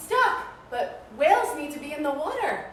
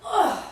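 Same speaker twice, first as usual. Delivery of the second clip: effort grunt; 8 semitones higher, 7 dB louder than usual. Acted. Pushing the whale.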